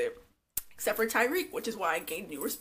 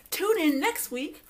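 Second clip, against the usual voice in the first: high, hammed-up promo sign-off; mock announcer; 6 semitones higher, 4 dB louder than usual. Telling viewers to tune in, mock announcer. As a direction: sell the next episode like a TV announcer.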